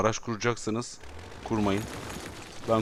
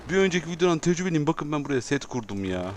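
usual speaking voice

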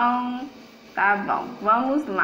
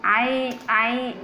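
ordinary delivery

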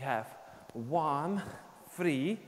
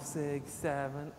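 ordinary talk

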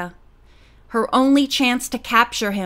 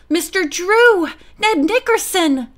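high, louder call of relief; relieved cry; 8 semitones higher, 4 dB louder than usual. A narrator's reading. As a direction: call out, relieved to see help. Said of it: "the cousins cried in relief".

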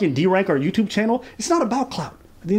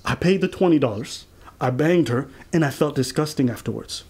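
retold confession; 5 semitones lower, 3 dB quieter than usual. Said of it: "So he said".

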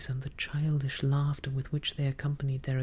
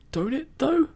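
high, loud eager pirate; joyful cry; 13 semitones higher, 8 dB louder than usual. A narrator's reading. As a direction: burst out with sudden hope. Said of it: "with a sort of joy".

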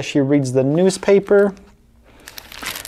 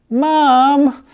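put-on high, louder child's call for mom; crying out; 11 semitones higher, 4 dB louder than usual. Little boy calling out to mom, high child cry. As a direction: call mom for help like a small kid. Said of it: "cries the little boy".

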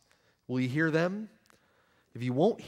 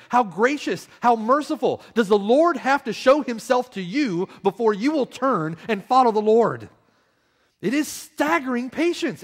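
higher, louder impassioned preaching; full-force preaching; 9 semitones higher, 9 dB louder than usual. Acted. Preacher urging unbelievers to turn to the Lord, impassioned full-voiced preaching. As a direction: plead with the sinner to turn, full voice. Preacher pleading with hearers to repent.

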